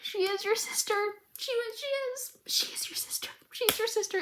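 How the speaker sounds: high, softening, breaking plea; pleading with the screen. Real, not acted. Viewer pleading with Powder, high and hushed. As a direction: beg a character who can't hear you. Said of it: "I get it, though".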